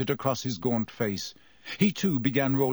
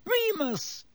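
very high frightened squeak. A narrator's reading. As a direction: squeak the name imploringly. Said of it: "Pettigrew squeaked".

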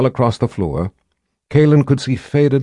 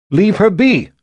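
put-on high, loud girl's repeat; defiant girl; 10 semitones higher, 5 dB louder, slower than usual. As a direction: refuse to back down, high and loud. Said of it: "Gillian repeated".

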